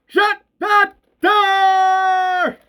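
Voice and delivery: drawn-out, high shout; shouting back. Acted. Bert repeating his order to friends, slow drawn-out shout. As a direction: stretch every word of the yell. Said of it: "shouting back".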